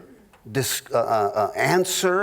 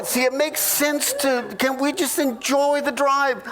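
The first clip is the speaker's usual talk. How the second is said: mock wife; 8 semitones higher than usual. Wife's answer in mock argument.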